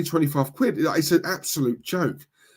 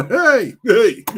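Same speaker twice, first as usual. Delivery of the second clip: laughing, louder, higher hey hey; playful hey; 8 semitones higher, 8 dB louder than usual. Real, not acted. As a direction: tease back a cheeky viewer. Laughing at viewer's cheeky comment.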